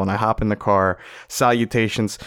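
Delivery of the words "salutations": usual speaking voice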